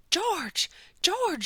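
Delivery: loud high call across the hall; shouting. A narrator's reading. Nurse chasing George down the hall, shouting his name. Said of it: "she shouted".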